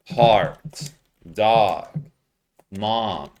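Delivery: "Heart", "dog", "mom" with one open ah vowel; same vowel. Shown as a demonstration. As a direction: stretch the same ah in each. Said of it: "they're all the same".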